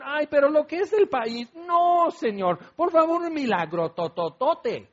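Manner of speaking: mock a picky complainer begging; fussy whining. Moses mocking the people's demand for better miracles, fussy whine. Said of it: "lo quejumbroso".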